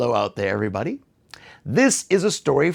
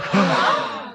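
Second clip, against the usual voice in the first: startled gasp; 6 semitones higher than usual. Acted. Animals wake, find no water.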